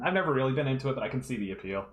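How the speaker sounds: ordinary delivery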